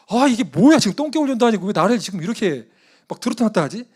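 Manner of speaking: high, loud, indignant grumbling; exasperated outburst. Retold or imitated. Preacher's reaction after being yelled at then dismissed, exasperated. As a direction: protest at being jerked around.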